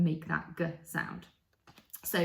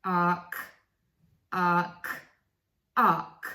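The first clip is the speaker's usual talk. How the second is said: closing the gap; 5 dB louder than usual. Shown as a demonstration. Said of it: "reducing that little gap".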